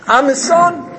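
high, loud acted cry; desperate protest. Rejected son calls out to the king, high desperate protest.

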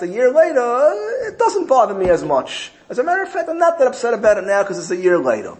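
wife conceding. Retold or imitated. Wife admits she is no longer upset, in a high voice. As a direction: give in, high-voiced and a bit sheepish.

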